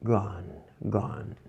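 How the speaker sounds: very soft, low practice repetition; reading under breath. Shown as a demonstration. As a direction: say it quietly to yourself. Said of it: "Sempre ler baixinho". (lendo bem baixinho)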